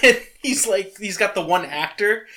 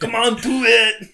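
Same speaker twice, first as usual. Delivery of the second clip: higher, louder movie-hero call; Arnold impression; 7 semitones higher, 7 dB louder than usual. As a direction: shout it like the action hero. Quoting Predator scene.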